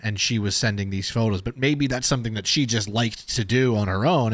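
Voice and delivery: ordinary delivery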